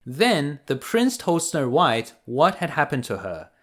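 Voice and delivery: ordinary delivery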